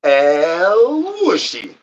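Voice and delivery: very slow, drawn-out strange voice; put-on voice. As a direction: stretch the name out in a silly character voice. Drawn-out put-on name call.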